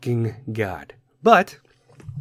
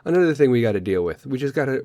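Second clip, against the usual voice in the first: ordinary talk, nothing marked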